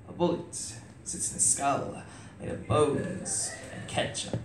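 hushed. Acted. Hushed line inside poem.

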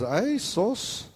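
high exclaiming Filipino doctor; doctor's exclamation. Retold or imitated. The Filipino doctor reacting to the chart, high exclaim. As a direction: mimic the doctor's surprised cry. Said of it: "and he was".

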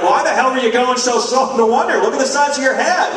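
mock road rage. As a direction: blow up at the driver ahead. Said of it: "You're like".

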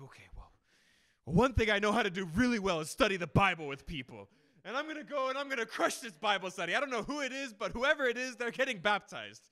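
loud, driving pep talk to himself; fired-up resolve. Retold or imitated. Preacher after a failed meeting, vowing to win a convert, loud. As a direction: pump yourself up, no doubt allowed. Resolving to crush Bible study.

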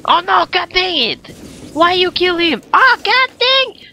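high, loud exasperated cry; high-pitched gamer frustration; frustrated outburst. Real, not acted. Frustrated at game death.

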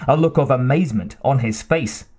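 ordinary delivery

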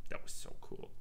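whispered in awe; hushed amazement; awed whisper. Real, not acted. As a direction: breathe it out in wonder. Awed whisper after video.

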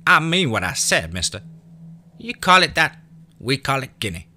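put-on high, loud stubborn country boy; a boy standing his ground; high defiant child's voice; defiant kid. A narrator's reading. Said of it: "a defiant look on his face".

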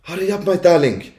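high grandmother voice calling out; loud and clear. A narrator's reading. Grandmother calling from the balcony, loud and clear. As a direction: call out high to be heard from afar. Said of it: "very loud and clear".

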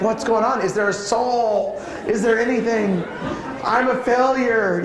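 a hammed-up parent at a loss, laughing; mock exasperation. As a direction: laugh through a parent's hopeless thoughts.

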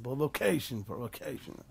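"Provocation" in English, low and soft; mock English loanword, said low as an aside; English pronunciation. Shown as a demonstration. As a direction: drop into English for the trendy word "Provocation". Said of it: "איזה מילים יש להם היום".

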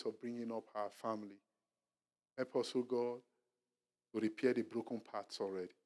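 soft, reverent prayer voice; closing prayer. Acted. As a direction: pray quietly over the congregation.

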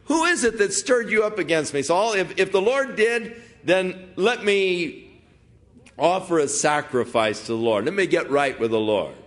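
calling out. Acted. Preacher voicing David calling to Saul, high pleading call.

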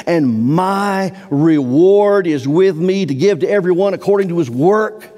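high, loud pulpit preaching, pressing hard; fervent preacher at full cry; full-force preaching. Acted. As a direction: drive the scripture home from the pulpit.

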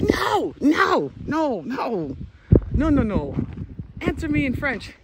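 high, emphatic repeated refusals; insistent protest. Retold or imitated. Learner stopping coworkers answering in English, insistent protest. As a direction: cut them off with mock exasperation.

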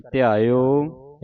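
ਧਿਆਇਓ with a drawn-out final o; lengthened ending. Shown as a demonstration. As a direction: hold the o at the end of ਧਿਆਇਓ. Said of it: "ਇਹਨੂੰ ਥੋੜ੍ਹਾ ਲੰਮਾ ਕਰਕੇ".

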